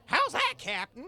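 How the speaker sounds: high, loud eager lackey voice; eager crewman. Acted. Barnacle Bill asks the captain, eager and high. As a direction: beg for the captain's approval. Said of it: "and he goes".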